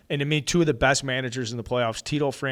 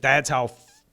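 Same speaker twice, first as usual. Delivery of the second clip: ordinary talk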